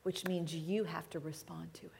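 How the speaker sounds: breathy hushed challenge; quiet, urgent whisper. Real, not acted. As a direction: whisper it to the room. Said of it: "sermon climax hushed".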